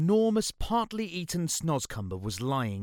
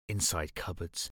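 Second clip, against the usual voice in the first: plain reading voice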